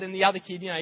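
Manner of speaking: usual speaking voice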